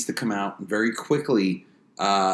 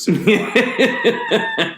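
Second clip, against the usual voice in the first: laughing; 14 semitones higher, 7 dB louder than usual. Real, not acted. Laughs through his point.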